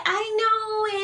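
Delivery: mock the friend acting innocent; playing innocent. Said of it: "she tries to play innocent".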